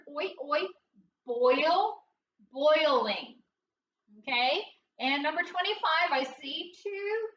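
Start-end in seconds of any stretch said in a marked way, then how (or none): 0.1-3.4 teacher sounding out boiling step by step; building the word. Shown as a demonstration. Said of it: "What's that word?".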